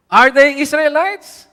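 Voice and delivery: loud, slow rising question; high, ringing rhetorical question; acted challenge. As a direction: call out the challenge, drawn out. Rhetorical challenge from quoted letter.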